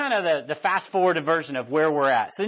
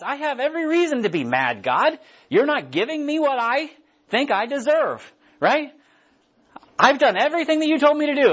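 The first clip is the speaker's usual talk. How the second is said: indignant Jonah; 6 semitones higher than usual. Acted. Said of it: "He's like, uh".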